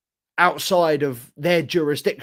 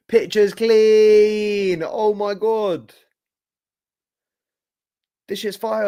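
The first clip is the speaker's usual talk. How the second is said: slowed right down, higher and louder with delight; hyped admiration; 6 semitones higher, 4 dB louder, much slower than usual. Real, not acted. Streamer marvelling at his new camera picture, drawn out and excited. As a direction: savour every word like it's amazing. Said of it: "admiring new camera picture".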